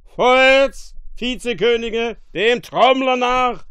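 very loud, high marching order; shouted command. A narrator's reading. Drunkard king ordering his viceroys to march, shouted command. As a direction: bellow it like a commander. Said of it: "dann befahl er".

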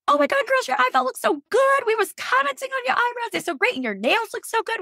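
put-on high, gushing girly voice of the nurses. Nurses gushing over her eyebrows in a high voice. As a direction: squeal the compliments like an excited nurse. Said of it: "they were like".

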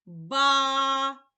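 Ба-а with a long drawn a; stretched first syllable. Shown as a demonstration. Teacher to child, stretching Ба-а sound by sound. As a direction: sound out Ба-а, holding the vowel. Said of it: "звуковий аналіз".

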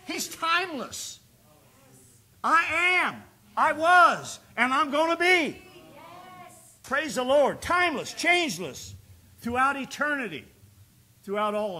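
loud, rising preacher's cry; preaching at full force. Acted. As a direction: drive it home to the congregation. Preaching climax to congregation.

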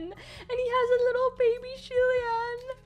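high-pitched cooing squeal; real gushing delight. Real, not acted. Fan gushing over a matching cute keychain, delighted.

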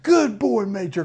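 put-on high, gushing good boy; big love praise. Shown as a demonstration. Trainer gushing praise at his dog, high and bright. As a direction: pour praise over the dog. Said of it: "I just get big love".

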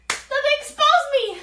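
excited outburst. Real, not acted. Punchline of the story, pitched high.

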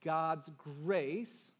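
normal talking voice

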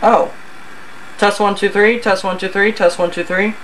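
ordinary delivery

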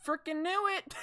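high shout then nervous laugh; scared laughing shout. Real, not acted. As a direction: yell it and crack up. Jump scared again.

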